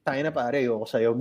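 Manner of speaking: low, soft grumbling curse; grumpy stoned guy. Retold or imitated. A hint of an annoyed stoner cursing the comic for refusing the joint. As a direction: mutter it like a sulky stoned man. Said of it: "nung galit".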